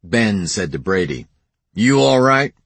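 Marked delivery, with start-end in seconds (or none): 1.7-2.5 loud, high concern. A narrator's reading. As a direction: call it over with worry. Ben checks on brother.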